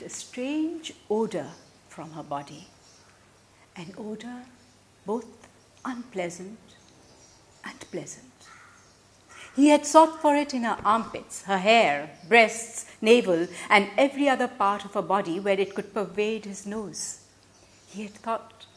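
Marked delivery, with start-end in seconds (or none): none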